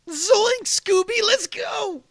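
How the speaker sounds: very high cartoon slacker voice; squeaky, goofy cartoon impression; Shaggy. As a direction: squeal it like a cartoon stoner about to run. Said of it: "What about Shaggy?".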